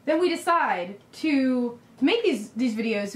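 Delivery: ordinary talk, nothing marked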